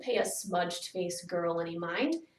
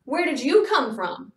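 loud, higher interrogation; sharp, raised questioning; accusing demand; 6 semitones higher, 9 dB louder than usual. A narrator's reading. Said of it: "they'd demand".

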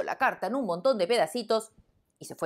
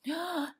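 shocked gasp; 13 semitones higher, 5 dB quieter than usual. Acted. Lion shocked by letter.

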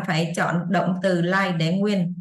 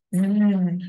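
long voiced "zzz" buzz; tongue to teeth, let it vibrate. Shown as a demonstration. Teacher showing students the third ending, buzzing z. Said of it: "cách thứ ba đọc là z".

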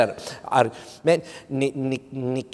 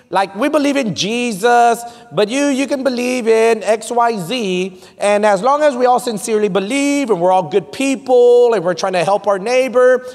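high, driving pulpit delivery; forceful preaching; 9 semitones higher, 4 dB louder than usual. Real, not acted. Preacher warning the church about false doctrine, forceful preaching. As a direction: preach it hard to a full room.

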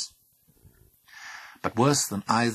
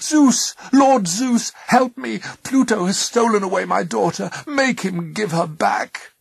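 high, loud desperate appeal; distraught plea; 13 semitones higher, 8 dB louder than usual. A narrator's reading. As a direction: cry out to a lord for justice. Mother begging Zeus for help.

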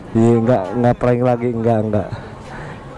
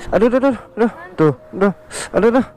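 high, whiny mock groans of pain; faked pain; 7 semitones higher, much faster than usual. Pranking his girlfriend with a fake injury, hammed-up high aduh. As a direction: ham up being hurt, high-pitched.

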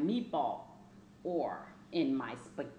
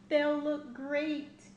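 higher, louder and slow with delight; excited; 9 semitones higher, 4 dB louder, slower than usual. A narrator's reading. Child reader excited about new big teeth. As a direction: savour it, proud and eager.

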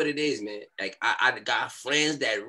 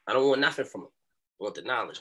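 normal talking voice

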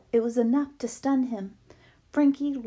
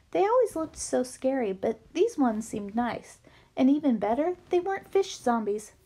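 ordinary delivery, nothing marked

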